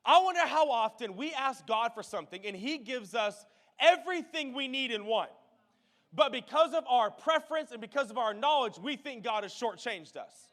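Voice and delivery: preaching. Acted. Pastor applying the coin story to the congregation, preaching. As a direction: drive the lesson home to the church.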